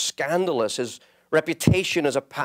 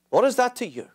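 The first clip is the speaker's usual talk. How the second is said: raised repeat; 10 semitones higher, faster than usual. Shown as a demonstration. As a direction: throw the repeat up hard.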